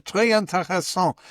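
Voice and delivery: put-on playful French accent. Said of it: "speaking with a French accent".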